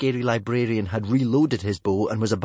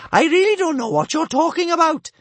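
very high, loud bellow; a mother blustering her way out, bellowing; bellowing Bertha; 10 dB louder than usual. A narrator's reading. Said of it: "she bellowed".

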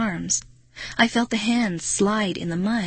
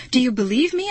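high, urgent question; anxious raised-pitch cry; 4 semitones higher, faster than usual. A narrator's reading. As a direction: demand it, voice rising. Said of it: "I cried".

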